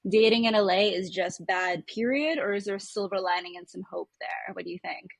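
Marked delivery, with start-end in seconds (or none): none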